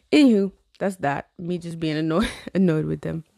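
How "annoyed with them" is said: ordinary talk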